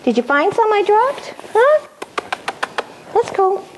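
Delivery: high, bright-eyed coaxing for a puppy; excited puppy talk. Shown as a demonstration. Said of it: "excited about this".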